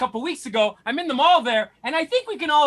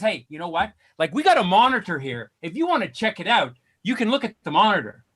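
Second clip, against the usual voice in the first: retold doctor; 6 semitones lower than usual. Retold doctor offering monitor, low.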